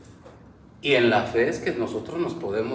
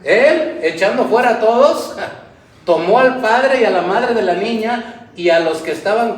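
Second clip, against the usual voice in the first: louder preacher's climb; preaching at full force; 6 semitones higher, 7 dB louder than usual. Acted. Preacher telling the church how Jesus cleared the house, loud. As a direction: build the story up for the church.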